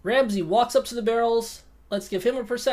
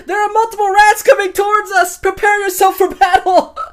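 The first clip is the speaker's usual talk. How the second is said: loud, high shout to the allies; shouted warning; 6 semitones higher, 6 dB louder than usual. Acted. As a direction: warn the party, loud and urgent. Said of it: "I shout to Ramsay".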